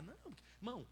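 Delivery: usual speaking voice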